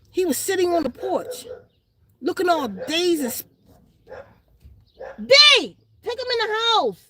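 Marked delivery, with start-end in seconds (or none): 5.2-7.1 loud call across the room; calls out loudly to someone nearby. Real, not acted. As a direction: shout to someone off mic. Calling out to others.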